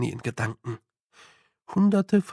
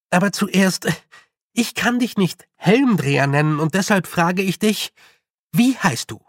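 put-on higher, louder girl's voice, firm demand; higher and forceful, like a girl taking charge; determined young girl; 5 semitones higher, 5 dB louder than usual. A narrator's reading. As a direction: put the question to him firmly. Tiffany addresses the little man.

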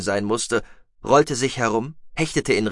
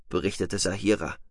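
usual speaking voice

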